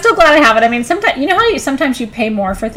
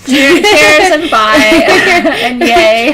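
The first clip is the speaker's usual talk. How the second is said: laughing; 5 semitones higher, 10 dB louder, much slower than usual. Real, not acted. Giddy sign-off after sale.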